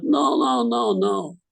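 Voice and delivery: put-on high, loud Russian accent correction. Russian teacher correcting pupil's touch, loud high Russian accent. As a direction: stop him short like a scolding Russian maestro. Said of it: "in his heavy Russian accent".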